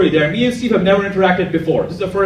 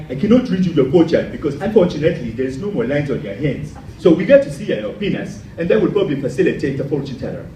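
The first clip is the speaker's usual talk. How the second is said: mock Nigerian fortune teller's accent; 4 semitones lower than usual. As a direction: put on a Nigerian accent for a psychic's pronouncement. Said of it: "I did that in that accent".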